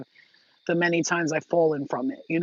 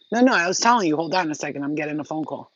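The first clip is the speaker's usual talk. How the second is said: ordinary talk, nothing marked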